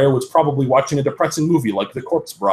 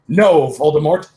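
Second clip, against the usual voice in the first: louder, defiant no; shouted refusal; 5 semitones higher, 6 dB louder than usual. A narrator's reading. Heroine refuses Voldemort, shouting back. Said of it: "I shouted back".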